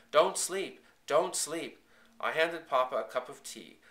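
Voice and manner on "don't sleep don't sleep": high, loud, urgent plea; raised, insistent alarm; frantic. A narrator's reading. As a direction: plead loudly to keep her awake. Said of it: "louder, frantically".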